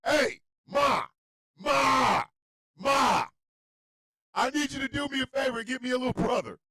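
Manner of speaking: high, loud calls to Ma; hammed-up insistent kid voice; demanding granddaughter. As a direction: holler for mom like a bossy toddler. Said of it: "Michael Pruitt's granddaughter said".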